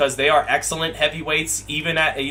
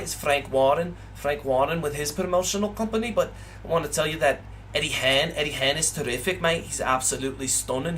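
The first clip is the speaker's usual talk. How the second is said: put-on Scouse accent; 2 semitones lower, 6 dB quieter than usual. Streamer doing a Scouse fan praising and slating Eddie Hearn, in a put-on Liverpool accent. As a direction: play a cheeky Scouse bloke with a so-so Liverpool accent. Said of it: "the Liverpool Scouse thing".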